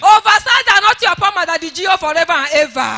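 loud, forceful church prayer; fervent prayer. Real, not acted. Testifier blessing the daddy G O before church, fervently. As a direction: pray it out with full force. Prays aloud for the G O.

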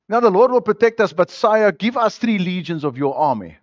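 high, loud mocking plea to a king; mock petitioner begging the king. Preacher mocking doubters who beg the king for an army, high and loud. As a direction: ham up a timid believer asking for soldiers.

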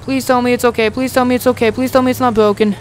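high, pleading voice; desperate pleading. Real, not acted. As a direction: beg for it to be okay. Pleading after loss.

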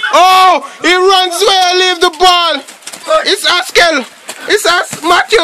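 high-pitched, surprised match call; startled play-by-play. Real, not acted. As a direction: react as the play goes wrong. Reacting to player losing ball.

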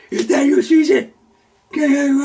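high, loud call across the water. Acted. Peter calling to Jesus across the water, a high loud cry. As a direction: shout out to a figure on the waves.

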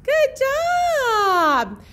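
loud, high cheer; excited good job; cheering praise. Real, not acted. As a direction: celebrate the little one. Praising child.

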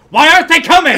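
high, very loud mock outrage; exasperated director's yell. Imagined director turning to his assistant, exasperated yell. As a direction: shout it as a fed-up demand. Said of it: "Turning to his assistant".

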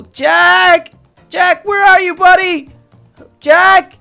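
loud, high calls for a missing friend; calling out. Acted. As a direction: call into the distance for your lost buddy.

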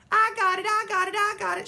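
high, loud triumphant squeal; excited scream. Acted. May grabbing the egg first, triumphant scream. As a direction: shriek it in victory. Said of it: "she screamed".

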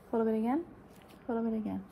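usual speaking voice

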